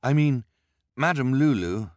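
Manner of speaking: a lightly voiced anxious boy; Klaus urgent. A narrator's reading. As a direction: catch her attention fast.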